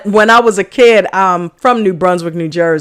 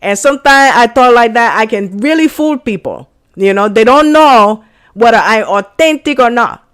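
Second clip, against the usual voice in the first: put-on Spanish accent. Showing off to viewers how authentic you sound, Spanish accent. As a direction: talk English with a Spanish accent to fool people. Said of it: "I can really do a good accent".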